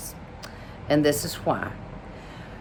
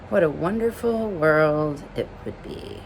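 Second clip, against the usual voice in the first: usual speaking voice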